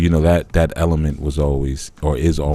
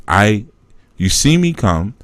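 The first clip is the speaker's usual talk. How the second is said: ordinary talk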